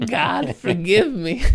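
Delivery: slow, drawn-out, laughing through it; laughing plea. Real, not acted. Stoned podcaster laughing through a mock plea for forgiveness. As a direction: beg forgiveness while cracking up.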